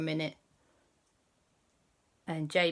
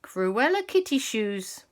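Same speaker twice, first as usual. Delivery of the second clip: ordinary delivery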